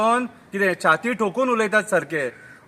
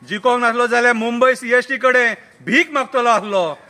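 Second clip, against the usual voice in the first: satirical poem recital; 5 semitones higher, 4 dB louder than usual. Acted. Reciting the turncoats' confession to friends, declaimed verse.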